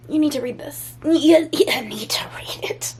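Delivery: hushed, urgent insistence to a friend; imitating her own texts as a whisper; mock urgent whisper. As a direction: whisper it like a secret tip. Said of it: "and I'm like".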